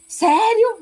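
pergunta incrédula, aguda e alta; grito de surpresa recontado. Retold or imitated. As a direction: solte um gritinho de espanto ao recontar. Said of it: "dei um grito". (grito surpreso, encenado)

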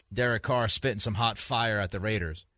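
usual speaking voice

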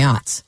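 "ots" with an open ah vowel; "ots" for oats. Shown as a demonstration. Host joking back about sown oats, "ots" with ah. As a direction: say "ots", rhyming with "lots". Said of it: "ot instead of oat".

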